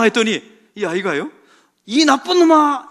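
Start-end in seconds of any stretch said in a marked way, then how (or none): 1.9-2.9 high, loud, stretched-out insult; mock angry shout. Son returning the bully's insult, mock angry shout. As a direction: throw the name back in playful mock anger.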